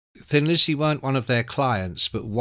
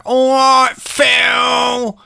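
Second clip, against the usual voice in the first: loud, high jeering greeting; mock rowdy shout; 12 semitones higher, 9 dB louder, much slower than usual. [name] yelling a greeting at [name]. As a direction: bellow it across the party like a lout.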